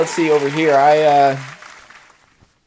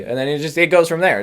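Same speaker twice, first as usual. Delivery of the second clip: ordinary delivery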